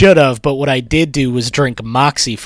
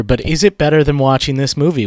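ordinary talk, nothing marked

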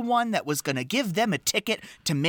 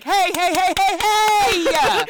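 high, loud rallying cry; rallying shout; 13 semitones higher, 5 dB louder than usual. Retold or imitated. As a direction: shout them to attention, urgent. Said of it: "And he's like".

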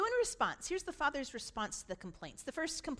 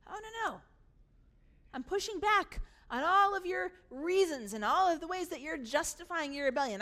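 firm pushback; 5 semitones higher, 7 dB louder than usual. Acted. Father pushing back.

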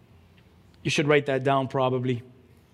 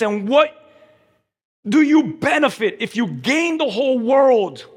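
forceful preaching; 9 semitones higher, 7 dB louder than usual. Real, not acted. Preacher urging the crowd to surrender, forceful preaching. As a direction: drive each phrase home to the congregation.

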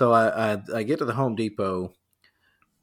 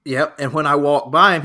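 ordinary talk

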